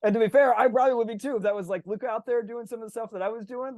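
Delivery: usual speaking voice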